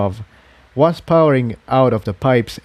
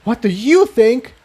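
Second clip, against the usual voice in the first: snapping back in a higher voice; sharp higher-voiced snap; 12 semitones higher than usual. A narrator's reading. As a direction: bite the words off, irritated. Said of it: "the purple pony snapped".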